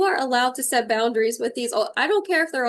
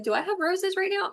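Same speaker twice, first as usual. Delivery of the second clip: higher-pitched mock wondering; puzzled self-question; 8 semitones higher than usual. Medium puzzled by a heard message, high wondering question. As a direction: lift the voice as if asking yourself.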